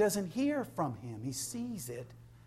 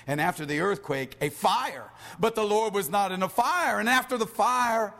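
raised, forceful preacher's scripture reading; 4 semitones higher, 4 dB louder than usual. Acted. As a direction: proclaim the verse to the congregation. Reads scripture aloud with force.

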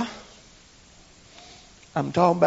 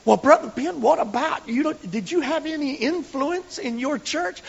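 higher, put-on asking voice; questioner's voice; 9 semitones higher than usual. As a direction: ask like a doubtful church member. Quoting a questioner.

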